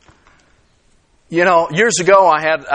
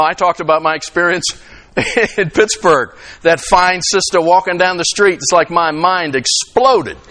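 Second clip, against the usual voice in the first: ordinary delivery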